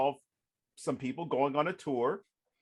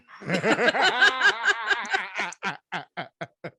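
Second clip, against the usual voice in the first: loud high burst of laughter; big laugh; 10 semitones higher, 6 dB louder than usual. Real, not acted. Author admits favorite death, loud high laugh. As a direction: crack up at your own twist.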